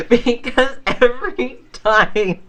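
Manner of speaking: high-pitched, breaking into real laughter; cracking up. Real, not acted. Speaker recalling Dee Dee's catchphrase, cracking up. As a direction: squeal the words through helpless laughter. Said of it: "we crack up every time".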